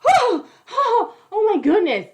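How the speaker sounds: high, loud shocked "oh my goodness"; hammed-up gasping shock, laughing; mock gasp. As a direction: clutch your pearls in fake shock.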